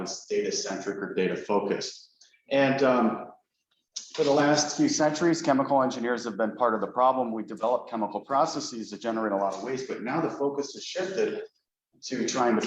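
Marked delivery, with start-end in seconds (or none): none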